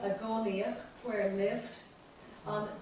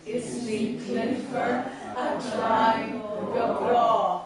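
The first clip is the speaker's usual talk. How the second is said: loud, measured verse recitation; group recitation lead; 6 dB louder than usual. Shown as a demonstration. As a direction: declaim the verse for all to join in. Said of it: "let's say it together from the beginning".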